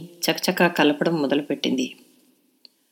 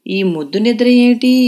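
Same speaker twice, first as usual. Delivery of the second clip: sing-song grandmother; 3 semitones higher, 4 dB louder than usual. A narrator's reading. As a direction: chant the scolding to wake the lazy girl. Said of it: "అని రాగం తీసి". (అమ్మమ్మ రాగం తీస్తూ తిట్టడం)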